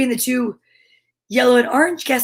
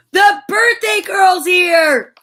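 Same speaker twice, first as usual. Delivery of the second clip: excited gasp; 9 semitones higher, 5 dB louder, much slower than usual. Real, not acted. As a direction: gasp then a high, slow excited shout. Birthday surprise.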